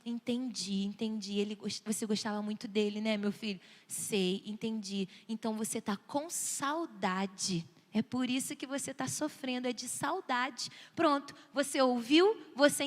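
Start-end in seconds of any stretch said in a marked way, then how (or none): 0.1-9.7 low, soft, patient voice; understanding parent. Shown as a demonstration. Parent listening to child missing a friend, low and tender. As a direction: soothe and gently draw the child out. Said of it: "Então, vamos ouvir".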